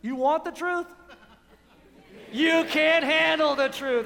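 hammed-up courtroom outburst; movie line impression. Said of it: "He goes".

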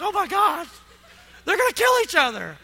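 a hint of startled, laughing panic; mock alarm. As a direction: gasp like a kid watching parents fight. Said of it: "like the kids watching the parents fight".

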